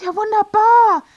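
high, loud burst of enthusiasm; delighted exclamation. Acted. Wife hearing husband may get work, delighted. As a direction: exclaim it with the voice lifted.

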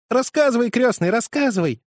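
put-on high, loud eager cry of children; children exclaiming. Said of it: "воскликнули дети".

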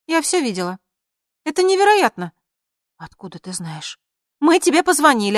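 excited Lina. Acted. Friend Lina on the call telling the news, high and excited. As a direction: chatter eagerly, high and loud.

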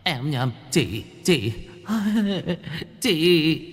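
nervous, high stuttering fix with a laugh; stammering correction. A narrator's reading. Ghost hastily correcting anh to chị, stammering. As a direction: scramble to correct himself. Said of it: "lắp bắp".

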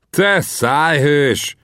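slow, high-pitched scornful cry; shouting back. A narrator's reading. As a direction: stretch the insult as you call it back. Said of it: "kiáltottam vissza".

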